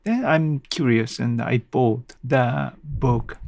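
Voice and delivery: ordinary delivery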